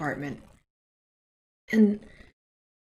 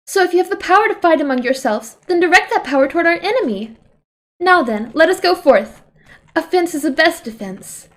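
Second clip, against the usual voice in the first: regal princess voice; 7 semitones higher, 4 dB louder than usual. Acted. Voicing Sonia's royal speech.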